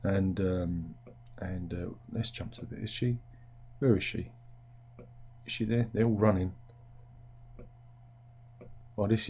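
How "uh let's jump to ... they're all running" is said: usual speaking voice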